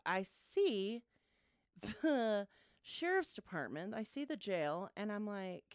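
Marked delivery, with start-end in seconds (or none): none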